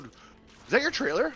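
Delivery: a high, startled question; surprised neighbour. Retold or imitated. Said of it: "they said".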